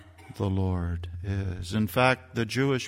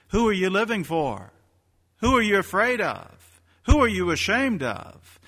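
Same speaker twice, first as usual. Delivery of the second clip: high, loud questions hurled at the pews; challenging preacher; 8 semitones higher, 4 dB louder than usual. Acted. Preacher challenging his congregation, higher and louder. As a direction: press each who home.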